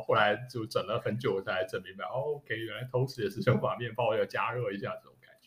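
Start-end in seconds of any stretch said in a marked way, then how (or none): none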